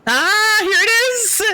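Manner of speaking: high, loud excited cry; excited discovery. Acted. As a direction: cry out on spotting it. Character makes a sudden discovery.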